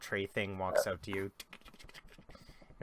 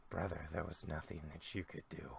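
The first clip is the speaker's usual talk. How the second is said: softer, lower, comforting brotherly words; gentle consolation; 4 semitones lower, 9 dB quieter than usual. Acted. Emaciated brother comforting the grieving father, soft and gentle. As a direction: ease his grief softly.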